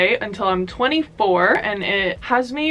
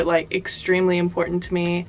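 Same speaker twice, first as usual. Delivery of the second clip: usual speaking voice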